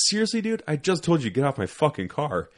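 high-pitched, incredulous telling-off; retold exasperated cop. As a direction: act out a cop who can't believe it. Exasperated cop scolding Henny, acted out.

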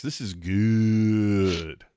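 very slow, stretched-out delight; drawn-out relish. Real, not acted. Telling co-host the pick looks great, savouring it. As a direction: savour it, holding GOOD long.

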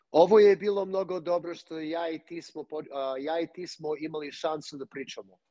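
a fluent Serbian sentence, natural and easy; spoken in Serbian. Shown as a demonstration. Guest showing off his Serbian on the host's request, fluent Serbian. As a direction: show the host how Serbian sounds. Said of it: "I'll say this in Serbian".

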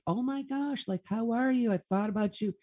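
high, bright surprise at seeing her again; excited greeting. Retold or imitated. Greeting the woman from Michigan at the conference, excited and high. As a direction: gush over an old client you spotted. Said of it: "I'm like".

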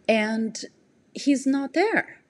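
ordinary delivery

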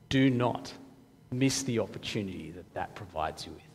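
low, soft and grave; hushed solemn warning. Real, not acted. Preacher telling the congregation of guilt to be paid, hushed and solemn. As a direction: drop low and quiet to let it weigh.

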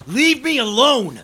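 desperate shout, high and loud. Acted. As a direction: scream it at them. Struggling against being taken.

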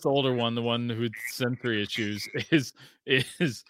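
usual speaking voice

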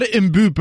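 Mbappe garbled as "im-BOO-per"; mock mispronunciation. Co-host teasing the host's earlier slip, Mbappe garbled as "im-BOO-per". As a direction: mangle Mbappe on purpose with a "boo" in it. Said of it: "or whatever you said".

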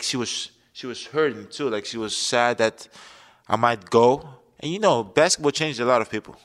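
ordinary talk

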